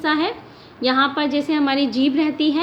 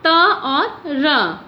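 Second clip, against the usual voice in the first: त और र drawn out very slowly; slow breakdown; much slower than usual. Shown as a demonstration. Teacher to students, breaking the cluster down slowly. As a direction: split the letters apart slowly. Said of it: "फिर है त्र".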